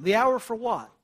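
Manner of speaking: quiet rhetorical question. Acted. Preacher puzzling over Jesus' words, quiet rhetorical question. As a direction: pose the question softly, almost as an aside.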